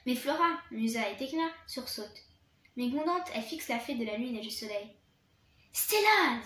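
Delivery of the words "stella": calls the name out loud and sharp. Acted. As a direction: snap her name out to stop her. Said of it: "dit Flora". (Flora interpelle Stella d'une voix forte)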